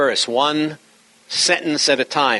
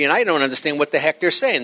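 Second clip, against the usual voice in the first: ordinary talk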